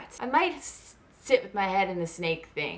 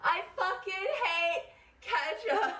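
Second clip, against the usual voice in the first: high, slow, laughing through it; laughing dog voice; 10 semitones higher, 6 dB quieter, slower than usual. Pretend. Dog confessing its hatred, laughing. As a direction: crack up mid-line as the dog.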